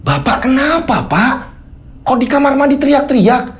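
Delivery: high, anxious questioning; alarmed wife. Acted. Wife asks why husband screamed in bathroom, high and alarmed.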